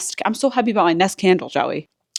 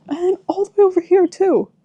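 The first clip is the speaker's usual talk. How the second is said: excited squeal; 11 semitones higher, 5 dB louder, faster than usual. Real, not acted. Balcony continues, still thrilled.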